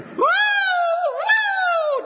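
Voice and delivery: high loud whooping cry of joy; joyful scream. Retold or imitated. Woman at the airport screaming with joy, high whoop. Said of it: "she just screaming".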